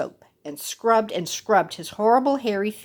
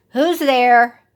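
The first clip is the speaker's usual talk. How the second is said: put-on loud little kids calling; 3 semitones higher, 9 dB louder than usual. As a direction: call out like small goats. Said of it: "cried the seven little kids".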